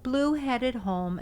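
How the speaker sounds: ordinary delivery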